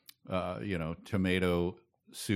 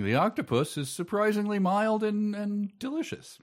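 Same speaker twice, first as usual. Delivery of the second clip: high, surprised delight; 13 semitones higher than usual. Real, not acted. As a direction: gush about it in a high voice. Surprised praise of the octopus.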